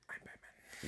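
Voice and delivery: hushed gravelly-free Batman whisper, low and unvoiced. Friend jokes about his brother as Batman, whispered Batman voice. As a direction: breathe it like Batman in the dark. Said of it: "He'd be like".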